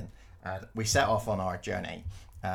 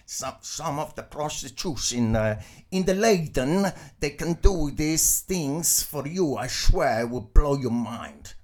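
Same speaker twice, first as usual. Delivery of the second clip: put-on slow, higher, louder Dutch-accented English; 6 semitones higher, 6 dB louder, slower than usual. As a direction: play the Dutch businessman, slow and emphatic in a Dutch accent. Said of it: "that was my kind of Dutch accent there".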